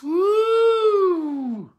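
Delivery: long, high whoop; preacher's whoop. Real, not acted. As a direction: draw out a high, arching woo. Preacher whoops after scripture.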